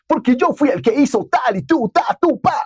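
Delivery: mock his friend's squeaky showoff voice. Teasing a friend who bragged about his commercial, high mock voice. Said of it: "siempre hacía la voz".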